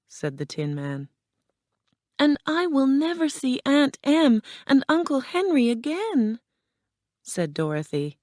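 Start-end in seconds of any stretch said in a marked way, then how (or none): 2.2-6.4 put-on high, upset girl's voice; tearful lament. A narrator's reading. Dorothy despairs of seeing her family, high tearful voice. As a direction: keep it pitched high, close to tears. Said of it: "and her tears fell".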